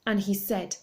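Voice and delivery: ordinary delivery, nothing marked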